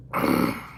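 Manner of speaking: rough throat clear from the heat; low throat clear after spice. Real, not acted. After tasting hot pepper.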